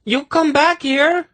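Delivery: very high-pitched indignant call; shouting after him. A narrator's reading. Senedra calling after the departing gnome, high-pitched shout. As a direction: call the runaway back in a high voice. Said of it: "she shouted after him".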